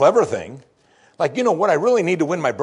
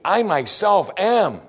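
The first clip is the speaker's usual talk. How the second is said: slow, weighty; 5 semitones higher, much slower than usual. Shown as a demonstration. Solemn translation.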